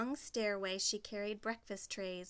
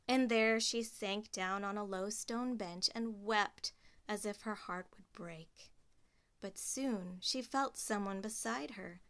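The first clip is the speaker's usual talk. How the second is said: ordinary delivery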